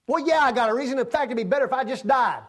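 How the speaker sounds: high, loud, fast bitter snap back; Jonah's retort. Acted. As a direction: fling it back at God in a sulk.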